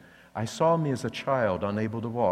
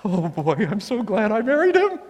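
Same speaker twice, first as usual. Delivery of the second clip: mock-giddy wife, pitched much higher and louder; swooning wife; 10 semitones higher, 5 dB louder than usual. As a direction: gush like a delighted wife, hammed up. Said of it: "you're like".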